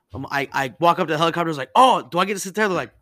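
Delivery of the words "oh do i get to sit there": bright, eager and louder, pitched up; eager question. Retold or imitated. New airman asking the crew about the seat, eager and louder. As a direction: ask like a thrilled new kid on his first flight.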